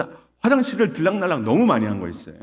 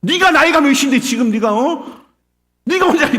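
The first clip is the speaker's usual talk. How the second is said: loud, high exasperated telling-off; mock furious yelling; scolding parent; 12 semitones higher, 9 dB louder than usual. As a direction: act out a harsh parent berating a child. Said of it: "간혹 그런 부모가 있어요".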